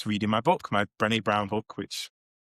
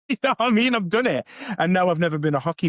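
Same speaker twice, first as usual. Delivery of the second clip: high, louder, through a laugh; laughing admission; 8 semitones higher, 5 dB louder, faster than usual. Real, not acted. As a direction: laugh while owning up to it. Laughs about own closeted past.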